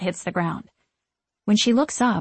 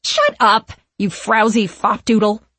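loud, haughty witch's snap; irritated witch hurling an insult; snapped; 2 semitones higher, 6 dB louder than usual. A narrator's reading. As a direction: cut them off sharply and imperiously. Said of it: "snaps Winifred".

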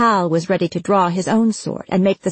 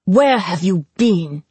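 slow, hard and louder; demanding; 4 dB louder than usual. Acted. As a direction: press him for an answer. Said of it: "Hal demanded".

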